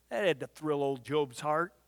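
deadpan sarcastic remark; dry sarcasm. Real, not acted. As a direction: say it with dry irony. Ironic comment on wife.

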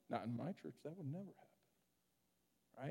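very soft, under-the-breath grumble; hushed disapproval. Retold or imitated. Preacher quoting words heard from anybody's mouth, hushed disapproval. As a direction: mutter it like a disapproving churchgoer.

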